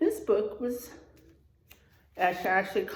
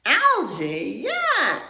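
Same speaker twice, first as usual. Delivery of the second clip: disgusted yuck; 3 semitones higher, 5 dB louder, much slower than usual. Acted. Harry the turtle rejecting algae, loud and disgusted. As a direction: recoil at the idea of algae. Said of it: "Harry replied, making a face".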